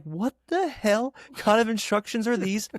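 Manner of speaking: high-pitched baffled exclamation; acted disbelief. Reacting to absurd label instructions, acted disbelief.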